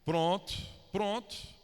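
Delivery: a cheerful Bahian "pronto, pronto"; Bahian accent. Retold or imitated. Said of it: "se fosse traduzir um baiano".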